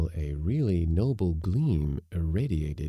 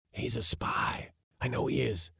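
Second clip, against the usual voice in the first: urgent whisper; 7 semitones higher than usual. Acted. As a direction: whisper it like a secret. Uncle suspicious, hushed.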